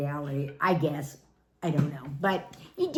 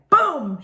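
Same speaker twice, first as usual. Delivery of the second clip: boom; 9 semitones higher, 8 dB louder than usual. Retold or imitated. Imitated cannonball splash.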